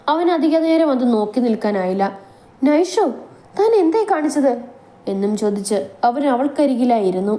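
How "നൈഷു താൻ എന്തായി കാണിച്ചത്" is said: high alarmed cry of her name; a man finding her hurt, asking in shock. Acted. Shan shocked at injured Naishu.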